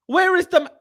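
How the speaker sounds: high, loud, cut-off demand; sudden high-pitched exasperation; exasperated demand. Real, not acted. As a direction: burst out demanding an answer, then break off. Demanding the missing correspondent.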